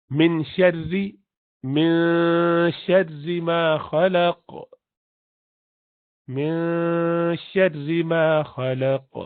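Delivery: شر with a thin, light ra; slow recitation, thin ra in شر. Shown as a demonstration. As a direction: recite شر ما خلق slowly, ra kept thin. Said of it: "ادھر را پتلا ہوگا".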